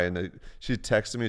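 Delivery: ordinary talk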